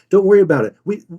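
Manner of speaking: high, quick and accommodating; forgiving buyer. Acted. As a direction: play a customer brushing the problem aside. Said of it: "they're already saying".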